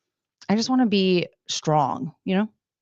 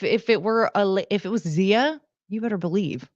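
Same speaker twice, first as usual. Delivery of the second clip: ordinary talk, nothing marked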